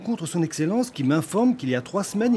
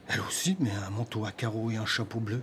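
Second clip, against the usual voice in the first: whisper; 5 semitones lower, 9 dB quieter than usual. Acted. Husband stunned by matching description.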